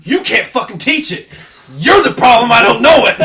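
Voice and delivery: loud, high angry blame; angry rant. Retold or imitated. Student stays after class to yell at the teacher.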